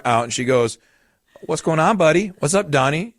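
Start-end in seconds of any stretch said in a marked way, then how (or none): 1.4-3.1 lightly voiced mom, higher pitch; mom addressing upset child. Mom filming her crying son, lightly imitated chirpy check-in.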